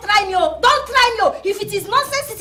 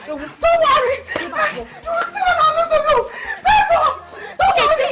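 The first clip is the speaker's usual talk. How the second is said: high, slow, sneering menace; slow taunting vow of revenge; mocking threat; 9 semitones higher, much slower than usual. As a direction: drag out the threat with a laugh.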